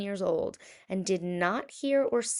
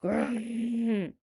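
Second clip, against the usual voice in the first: bear growl; 4 semitones higher than usual. Acted. Bear answering the old woman with a growl. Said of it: "growled Old Bear".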